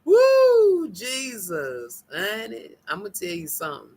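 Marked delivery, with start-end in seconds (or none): 0.0-0.9 very high loud whoop; woo of relief. Real, not acted. Catching breath after laughing.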